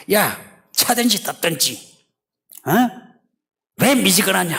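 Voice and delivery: loud, high, fed-up father; father scolding son. Acted. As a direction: challenge him like an exasperated father. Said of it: "아버지와 아들 간의 말에서".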